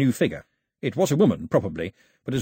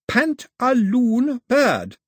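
very slow, high and loud; over-slow baby talk, raised pitch; very slowly; 13 semitones higher, 6 dB louder, much slower than usual. A narrator's reading. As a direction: stretch every syllable as if to a baby. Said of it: "she said, very slowly".

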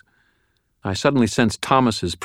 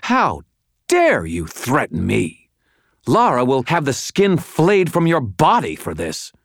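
high, outraged woman's snarl, building louder; snarled threat; 8 semitones higher, 5 dB louder than usual. A narrator's reading. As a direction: threaten him in haughty fury. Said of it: "Madeline snarled".

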